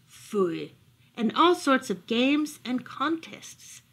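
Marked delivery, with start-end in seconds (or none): none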